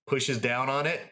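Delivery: usual speaking voice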